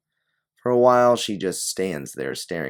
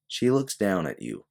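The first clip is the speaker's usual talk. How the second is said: ordinary delivery, nothing marked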